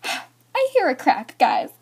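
high, slow, sighing horror; dismayed. Retold or imitated. Vlogger telling viewers her phone cracked, dismayed.

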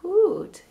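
high hooting like an owl. Shown as a demonstration. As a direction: hoot it out like an owl. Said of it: "What's an owl say?".

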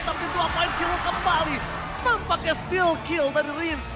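normal talking voice

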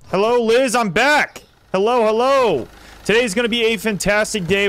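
high, upbeat greeting; calling out hello. Real, not acted. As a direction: sing out a cheery hello as you return.

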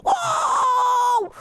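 high, drawn-out whoop; long wow. Acted. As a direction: stretch it into one high cry. Grido lungo durante il gioco.

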